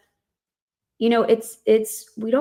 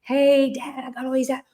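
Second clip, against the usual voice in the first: higher-pitched imitation of an excited kid; lightly acted excitement, pitched up; excited son; 4 semitones higher than usual. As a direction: mimic a boy eagerly telling Dad his news. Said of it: "He's really excited".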